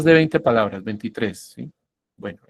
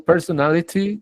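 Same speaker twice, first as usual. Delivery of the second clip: "personality" with a crisp British t; British-style "personality", t fully sounded, pitched higher; more British; 5 semitones higher than usual. Shown as a demonstration. Teacher contrasts two accents for students, more British. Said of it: "estoy hablando más británico".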